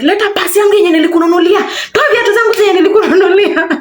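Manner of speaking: high, fast mock demand, breaking into laughter; mock demanding boyfriend. Boyfriend demanding back the gifts, high laughing mock demand. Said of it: "So he's like".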